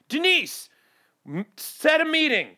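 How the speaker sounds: high bossy order to Denise; bossing the assistant. Pretend. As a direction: demand it like a fifties executive. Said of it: "assistant named Denise".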